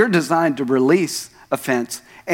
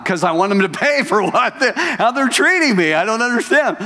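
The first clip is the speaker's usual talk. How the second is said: much higher, whiny put-on voice with a laugh; sulky natural man; 7 semitones higher than usual. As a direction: play the petty self who wants revenge. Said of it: "what did your natural man want to do?".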